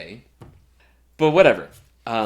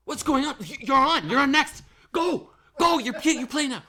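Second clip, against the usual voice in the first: much higher and louder, urgent panic; frantic hurry; 7 dB louder than usual. Retold or imitated. Stagehand yelling at violinist that she's on next, high frantic urgency. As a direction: rush her onstage in a high, loud panic.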